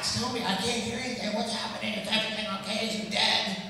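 high-pitched mock panic; anxious wife. Wife pressing husband for news of their son, anxious. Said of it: "her anxiety is rising, like, quickly".

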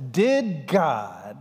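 put-on Satan's question in a smooth English accent. Preacher voicing Satan to the congregation, English accent. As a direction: ask it like a sly, clever Englishman. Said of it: "he says it with that English accent".

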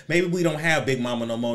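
ordinary talk, nothing marked

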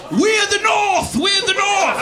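high, loud, slow hammed-up chant; mock battle cry. Mocking Canadians who call themselves the North, in a loud slow chant. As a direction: bellow it like a northern warrior.

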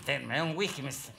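normal talking voice

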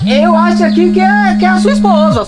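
loud call across the room. Acted. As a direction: raise the voice to reach him. Calling out across the bar.

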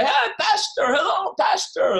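high, loud cheerful hail; calling back. Retold or imitated. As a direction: answer from the window, lifted and drawn out. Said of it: "like that".

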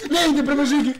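high, very loud yell of alarm; panicked shout. Real, not acted. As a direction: scream it in sudden panic. Panicked shout, game chase.

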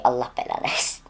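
breathless whispered curse under the breath. Real, not acted. Streamer cursing the case under his breath, whispered. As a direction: hiss the curse to yourself. Curses after bad drop.

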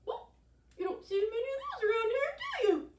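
high, fast, pinched little voice; tiny puckered voice. Acted. Frog with puckered lips answers the alligator, tiny pinched voice. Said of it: "made his lips as small as possible".

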